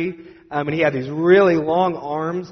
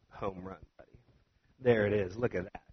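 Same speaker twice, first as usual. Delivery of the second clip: low, much softer self-satisfied boast; smug confidence; 7 semitones lower, 9 dB quieter than usual. Retold or imitated. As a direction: quietly gloat over a job well done. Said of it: "I was confident, arrogant probably".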